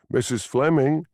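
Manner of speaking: calling her name from a distance. A narrator's reading. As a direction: call after her as she walks away. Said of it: "he called".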